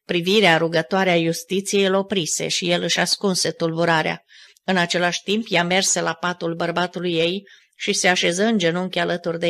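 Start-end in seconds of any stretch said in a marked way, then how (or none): none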